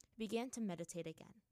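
plain reading voice

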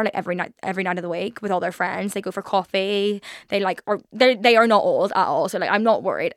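ordinary talk, nothing marked

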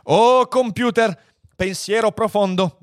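high, drawn-out formal address; composto e solenne. A narrator's reading. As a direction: call grandly on a mighty computer. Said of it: "assunse un'aria di grande compostezza". (invocazione solenne e acuta)

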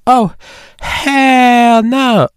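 very high, loud cartoon outrage; South Park voice. Muse refusing, high outraged cartoon voice. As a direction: shriek it like an outraged cartoon kid. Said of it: "God, I love South Park".

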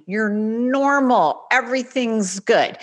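ordinary talk, nothing marked

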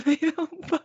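high, squeaky with laughter; laughing protest. Real, not acted. Host answering crab worry, high and laughing. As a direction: protest through giggles.

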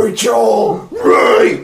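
hammed-up movie-hero shout; loud, overblown cry of loss; mock anguished scream. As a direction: bellow the name in mock anguish.

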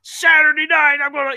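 high, loud wrestling promo yell. Pretend. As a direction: hype it up like a pro wrestler. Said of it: "It should be like, uh, wrestling, uh, promo".

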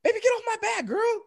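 very high, strained mock whine; hammed-up flustered falsetto; exasperated father. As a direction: squeal it like an overwhelmed dad. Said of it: "He's like".

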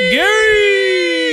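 very high, long held holler of the name; playful high-pitched crowing of a name; drawn-out name call. Pretend. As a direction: sing out the name, stretched way up high. Sports hosts drawn-out name chant.

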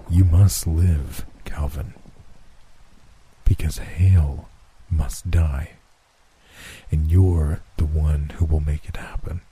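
slow, grave Death, ending in a whisper; slow solemn Death. Acted. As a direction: lay the fate on him slowly. Death lays hand on shoulder.

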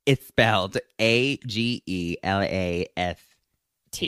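slow letter by letter spelling. Shown as a demonstration. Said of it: "for those of you wondering".

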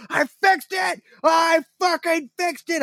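high, strained victory shout; re-enacted triumphant scream. Gamer celebrating a repaired computer, triumphant screaming. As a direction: yell it way up high, like reliving a win. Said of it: "And I'm screaming".

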